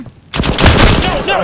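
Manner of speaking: loud high panicked no; panicked shout. Real, not acted. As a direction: scream it as you die. Dying in game.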